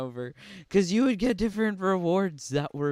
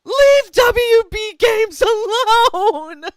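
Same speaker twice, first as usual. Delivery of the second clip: high, slow, shrieking plea; Chris Crocker; 14 dB louder, slower than usual. Retold or imitated. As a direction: wail it like a desperate fan. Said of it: "Chris Crocker style".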